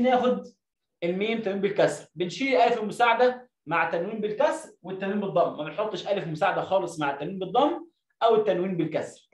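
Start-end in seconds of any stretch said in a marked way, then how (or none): none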